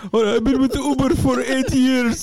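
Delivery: high, panicked mock dad voice; imitating the dad. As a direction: ham up a worried father's protest.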